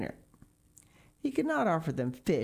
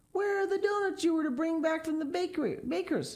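high, pointed mother's question; mother questioning her son; demanding mother; 13 semitones higher than usual. Acted. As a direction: press him for the doughnuts. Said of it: "his mother demanded to know".

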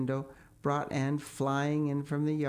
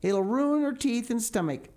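higher, sharper scold; disapproving; 7 semitones higher, 4 dB louder than usual. A narrator's reading. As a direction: object like a stern guardian. Marilla disapproving.

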